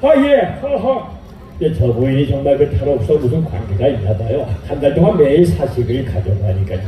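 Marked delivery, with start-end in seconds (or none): 1.6-6.8 put-on much lower, grumbling old shopkeeper; a low, gruff neighbour gossiping; gossiping shop owner. Charcoal shop owner gossiping about the wife, low gruff voice.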